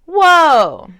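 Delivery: loud, high burst of surprise; real surprise at the card; startled whoa. Real, not acted. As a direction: jump at what you see.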